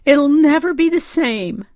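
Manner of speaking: high, loud wail; distraught lament. A narrator's reading. Distraught mother laments her car.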